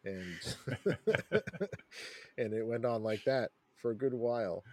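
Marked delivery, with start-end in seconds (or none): 0.4-2.3 loud burst of laughter; hearty laugh. Real, not acted. Laughs mid-story.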